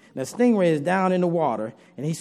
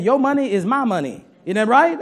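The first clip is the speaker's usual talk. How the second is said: higher, louder teasing wife voice; 5 semitones higher, 4 dB louder than usual. Retold or imitated. Wife claiming husband's money, high teasing voice. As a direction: tease him like the wife.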